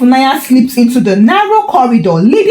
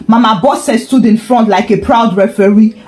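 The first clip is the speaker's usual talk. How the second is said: ordinary delivery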